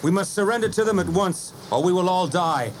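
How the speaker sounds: unhappy hero. A narrator's reading. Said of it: "he finished unhappily".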